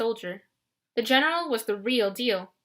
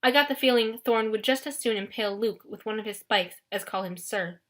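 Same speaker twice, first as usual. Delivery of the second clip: ordinary delivery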